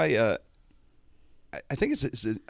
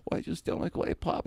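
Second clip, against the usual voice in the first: breathy, raised mock Fudd accusation; Elmer Fudd voice; 6 semitones higher, 4 dB quieter than usual. Kicker confronting Jimmy over the pop, soft mock Fudd. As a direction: accuse him in a soft cartoonish voice. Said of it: "Like that".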